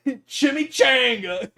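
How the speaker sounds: high squeal through laughter; cracking up. Real, not acted. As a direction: burst out with it, laughing helplessly. Cracking up at own silly word.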